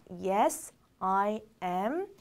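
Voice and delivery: slow, separated words; word-by-word model. Shown as a demonstration. Teacher modelling a short answer for learners, slowly word by word. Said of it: "라고 얘기합니다".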